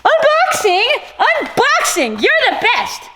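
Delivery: high, loud, gleeful calling out; exuberant cheer. Real, not acted. Vlogger praises the sender, high exuberant cheering.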